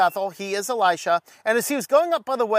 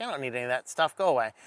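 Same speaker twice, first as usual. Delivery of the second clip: dismissive prophet; 8 semitones lower, 5 dB quieter, much faster than usual. Retold or imitated. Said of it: "But Elisha's like".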